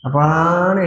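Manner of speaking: "அப்பான்னு" with a long-held middle vowel; drawn-out call. Shown as a demonstration. Child calling to father for a favour, "அப்பான்னு" dragged out on "பா". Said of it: "இழுத்தா".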